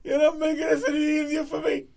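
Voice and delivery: high, strained near-laughing voice; barely holding it together; fighting laughter. Real, not acted. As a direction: plead while choking back giggles. Struggling not to laugh.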